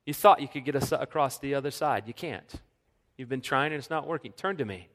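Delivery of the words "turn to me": gentle call. Acted. Pastor voices Jesus again.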